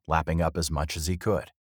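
ordinary delivery, nothing marked

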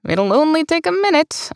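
high, complaining whine; griping. Acted. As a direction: gripe about the delay. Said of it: "I griped".

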